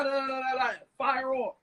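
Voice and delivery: ordinary talk, nothing marked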